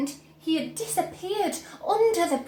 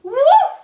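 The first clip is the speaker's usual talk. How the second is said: high, loud burst; loud whoosh sound effect; 12 semitones higher, 12 dB louder than usual. A narrator's reading. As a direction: pop it out. Sound of release.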